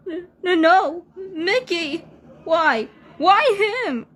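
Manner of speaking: high, loud, crying rage at the killer; anguished outburst. Acted. Rabbit screaming at the monster who ate Mickey, anguished. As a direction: scream your grief at him, voice breaking.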